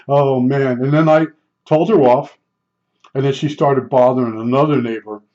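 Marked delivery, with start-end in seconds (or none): none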